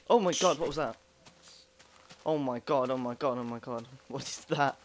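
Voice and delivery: startled shout, then panicked babbling; jump-scared gamer yelling; scared horror game streamer. Real, not acted. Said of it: "I literally just jumped then".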